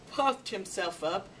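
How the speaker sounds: usual speaking voice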